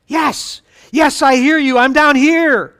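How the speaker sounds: high, loud, eager shout; shouting back. Acted. Jack answering the voice from down the cliff, eager shouting. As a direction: holler up to be heard.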